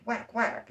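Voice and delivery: high double cartoon hit noise; two quick comic punches; whack sound effects. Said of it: "And like".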